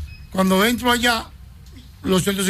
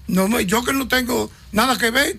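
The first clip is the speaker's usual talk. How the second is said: normal talking voice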